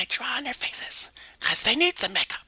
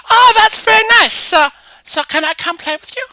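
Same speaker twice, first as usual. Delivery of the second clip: excited Mary; 10 semitones higher, 13 dB louder than usual. Acted. Mary, excited, higher, louder.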